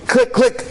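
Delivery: imitated jammed gun clicking. Jammed submachine gun, imitated clicking. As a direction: click it out like a dead trigger.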